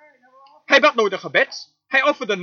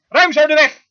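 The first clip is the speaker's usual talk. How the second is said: loud preached command; commanding call; 5 semitones higher, 6 dB louder than usual. Acted. Preacher booming God's order, commanding. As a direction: call it out for the road to clear.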